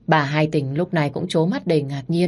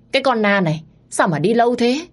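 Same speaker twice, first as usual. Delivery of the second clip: impatient scolding; 7 semitones higher than usual. Acted. Father impatient for the doctor.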